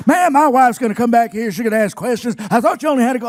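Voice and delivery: loud scolding shout; angry yell. Retold or imitated. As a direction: chew him out. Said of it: "he yelled at me".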